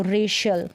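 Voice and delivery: "रेशियल" with sh mid-word; correct sh pronunciation. Shown as a demonstration. Teacher models racial for learners, correct sh pronunciation. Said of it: "हम पढ़ेंगे श".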